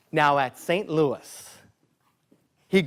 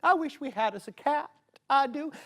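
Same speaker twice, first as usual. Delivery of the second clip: wistful townsperson; 7 semitones higher, 6 dB quieter than usual. Acted. Townsfolk wishing for a cat.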